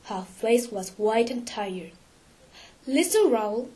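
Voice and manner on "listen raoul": ordinary delivery, nothing marked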